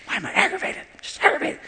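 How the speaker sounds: mock high-voiced aggravation; mock aggravated. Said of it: "you'll be mad".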